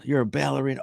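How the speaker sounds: put-on man humoring a child. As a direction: lightly play the delighted grown-up. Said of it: "He goes".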